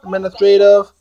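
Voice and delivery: ordinary delivery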